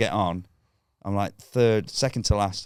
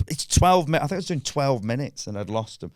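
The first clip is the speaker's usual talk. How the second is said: ordinary talk, nothing marked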